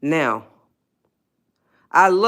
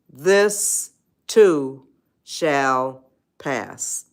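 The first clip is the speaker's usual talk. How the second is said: slow mantra; 4 semitones lower, much slower than usual. Shown as a demonstration. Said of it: "remember the four words".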